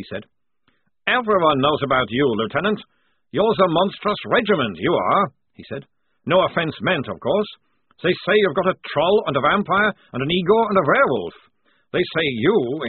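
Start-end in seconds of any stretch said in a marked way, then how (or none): 1.1-5.3 put-on higher, louder accented prisoner; taunting sergeant. A narrator's reading. Prisoner telling the lieutenant of his fame, taunting. 6.3-12.8 put-on higher, louder accented storytelling; cocky prisoner relishing the rumours; amused sergeant. A narrator's reading. Prisoner listing rumours about the regiment, amused. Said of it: "he began to chuckle".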